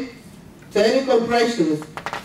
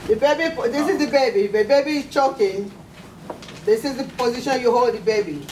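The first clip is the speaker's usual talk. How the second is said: ordinary delivery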